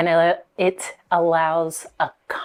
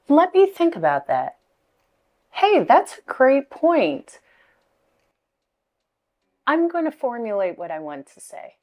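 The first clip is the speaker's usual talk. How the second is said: lightly acted reply; 5 semitones higher than usual.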